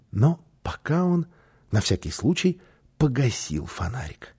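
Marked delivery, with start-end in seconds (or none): none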